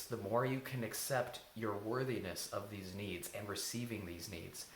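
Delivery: usual speaking voice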